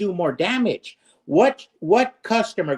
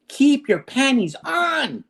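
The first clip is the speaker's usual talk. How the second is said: higher, slow, sneering call to the critics; mocking taunt; 5 semitones higher than usual. Host taunting impatient critics with a slow mocking jeer. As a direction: drawl it out like a taunt.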